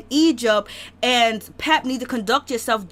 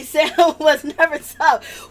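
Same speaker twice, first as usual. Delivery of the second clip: laughing; 2 semitones higher, slower than usual. Real, not acted. Laughs mid-line.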